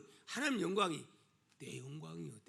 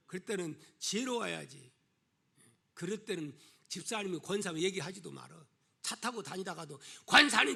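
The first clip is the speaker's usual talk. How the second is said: normal talking voice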